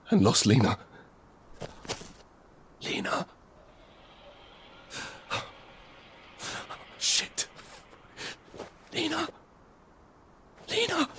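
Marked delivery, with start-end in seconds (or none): 2.8-11.1 hushed calling. Acted. Searching for lost Lena.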